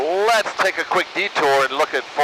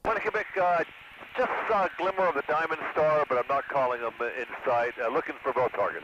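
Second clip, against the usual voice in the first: pilot radio call, quieter; over the radio; 8 dB quieter than usual. Real, not acted. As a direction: speak into the aircraft radio.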